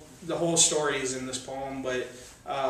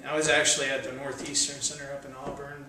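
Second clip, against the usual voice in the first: ordinary talk, nothing marked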